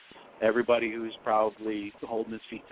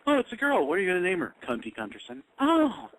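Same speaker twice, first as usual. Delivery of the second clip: mock nurse and parent; 8 semitones higher, faster than usual. Mocking delivery room dialogue.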